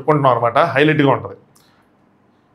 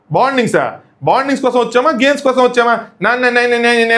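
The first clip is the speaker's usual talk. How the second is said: heated retort; 6 semitones higher than usual. Retold or imitated. [name] retold mocking Divya's bonding talk, high and heated. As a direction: pitch the retort up, needling. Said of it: "దాన్ని ఎక్కిస్తారు".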